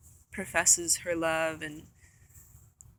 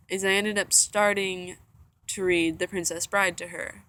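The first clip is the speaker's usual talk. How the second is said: usual speaking voice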